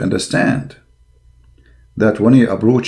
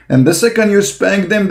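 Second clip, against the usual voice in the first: ordinary talk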